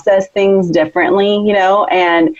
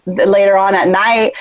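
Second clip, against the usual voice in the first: normal talking voice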